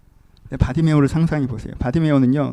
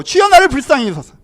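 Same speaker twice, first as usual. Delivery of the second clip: loud, high plea for mercy; acted crying out; 11 semitones higher, 10 dB louder than usual. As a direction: cry out like a desperate beggar. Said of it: "울부짖듯이 소리를 질러요". (울부짖듯 외치는 간청)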